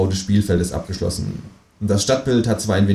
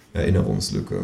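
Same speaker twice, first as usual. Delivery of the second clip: usual speaking voice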